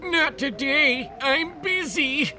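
high, slow put-on dad voice. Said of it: "I'm gonna do, I'm gonna do".

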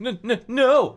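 high, loud stammer with laughter. A narrator's reading. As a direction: stammer it out in fright. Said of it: "I stammered".

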